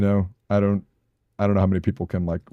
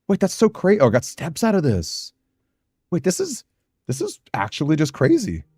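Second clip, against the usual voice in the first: amazed; 8 semitones higher than usual. Real, not acted. Amazed at result.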